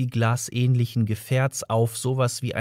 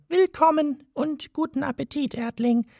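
high, tiny piping voice; a mouse's piping welcome; squeaky mouse voice. A narrator's reading. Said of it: "Eine piepsige Stimme sagte".

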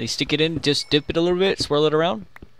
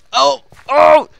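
surprised; 6 semitones higher, 6 dB louder than usual. Real, not acted. Sudden game danger.

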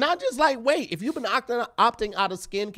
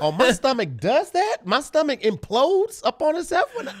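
high, loud horrified outburst; shocked dismay; 6 semitones higher, 7 dB louder than usual. Retold or imitated. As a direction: recoil at your reflection. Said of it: "you're like".

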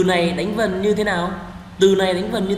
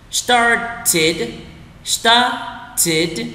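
started broken into two separate syllables; slow word-by-word; 5 semitones higher than usual. Shown as a demonstration. Teacher leads learners through the word, slowly split. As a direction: draw out started, pausing before the last syllable. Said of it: "đọc chậm từng từ một".

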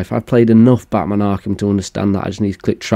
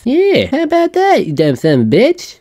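mock taunt; 13 semitones higher than usual. Taunting the intruder on screen.

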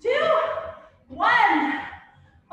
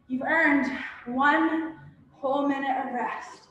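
usual speaking voice

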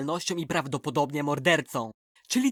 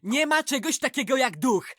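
angry outburst; 8 semitones higher, 5 dB louder than usual. Acted. Angry outburst about monsters.

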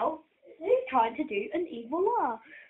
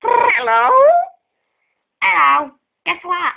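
loud prank phone voice; 2 semitones higher, 12 dB louder, slower than usual. Pretend. Finger phone prank call.

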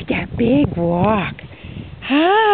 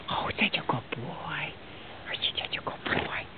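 whisper; 8 semitones lower, 18 dB quieter than usual. Real, not acted. Cooing to an animal.

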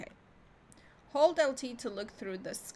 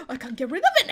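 loud, fast burst through laughter; gasp, then rush it out laughing, voice raised; laughing exasperation; 9 dB louder, much faster than usual. Real, not acted. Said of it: "I'm still talking like that".

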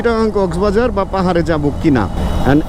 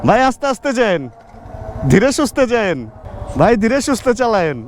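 raised-pitch shout-out to riders on the road; calling out; 4 semitones higher than usual. Real, not acted. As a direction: call higher to fellow riders. (ডেকে সাবধান করা, উঁচু স্বরে)

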